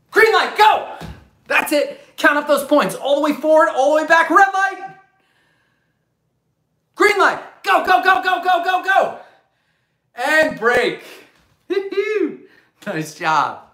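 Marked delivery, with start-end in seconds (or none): none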